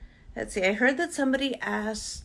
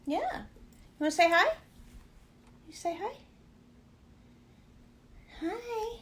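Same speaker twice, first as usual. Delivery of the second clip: pet talk; 10 semitones higher, 7 dB quieter than usual. Real, not acted. Dog asked to greet viewers.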